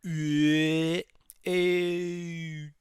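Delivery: high, drawn-out toy wail; nineties toy noise. Retold or imitated. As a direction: imitate a toy's long, high "eh". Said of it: "it sounds like that little toy from the nineteen nineties".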